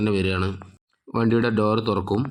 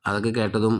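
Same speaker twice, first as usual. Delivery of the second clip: ordinary delivery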